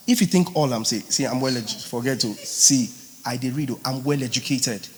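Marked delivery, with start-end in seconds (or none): none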